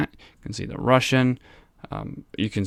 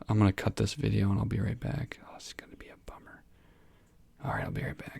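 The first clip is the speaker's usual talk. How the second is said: hushed unvoiced whisper; whispering an aside while the cat yowls outside; 8 dB quieter than usual. Real, not acted. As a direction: whisper it so the screaming cat won't hear. Cutting the video, cat screaming.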